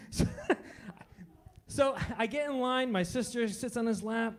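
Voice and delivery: ordinary talk, nothing marked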